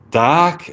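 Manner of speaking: "dark" like dahk, high rising; British dark. Shown as a demonstration. Practising the British accent, "dark" without R. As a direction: lift "dark" up, R dropped. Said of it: "but the British accent is".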